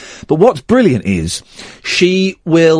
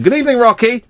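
high-pitched, playful welcome; hearty greeting; 10 semitones higher than usual. Real, not acted. Radio host greeting regular caller, high and hearty. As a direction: hail the caller brightly in a raised voice.

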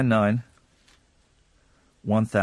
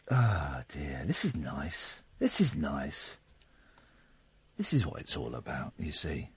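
soft contented murmur; 10 semitones lower, 11 dB quieter than usual. Real, not acted. Quiet contented aside.

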